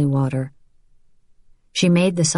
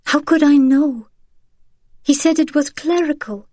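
much higher, pleading sister; Vianne, desperate; 11 semitones higher, 3 dB louder than usual. Acted. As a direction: plead that you couldn't have known. Vianne pleading her innocence.

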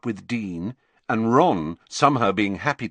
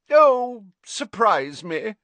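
much higher, louder breezy oh, slow; airy Slughorn; 14 semitones higher, 6 dB louder, slower than usual. A narrator's reading. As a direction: toss it off lightly, carefree. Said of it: "said Slughorn airily".

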